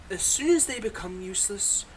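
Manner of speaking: ordinary delivery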